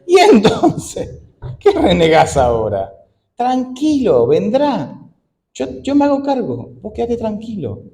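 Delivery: high, slow teasing God voice; God tells the worrier to relax, high and playful. Pretend. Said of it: "y Dios dice".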